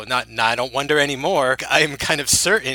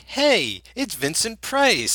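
high, slow mock introduction; mock announcer intro; 9 semitones higher, slower than usual. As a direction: announce the star in a hammed-up lilt. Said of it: "none of these are like".

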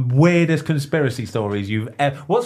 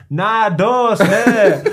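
imitating a broad northern English accent, louder; loud broad northern English accent, swearing; 8 semitones higher, 7 dB louder, much slower than usual. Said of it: "in his broad northern accent and goes".

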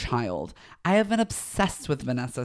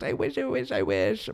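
whiny wish; 9 semitones higher than usual. Real, not acted. Wishing her brain worked.

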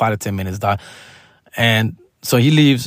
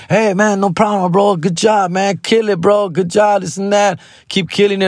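Joy Coy voice; 8 semitones higher than usual. Retold or imitated. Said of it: "Joy Coy answering thanks".

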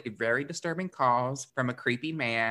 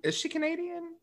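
whisper; 3 dB quieter than usual. Real, not acted. Aside to co-host.

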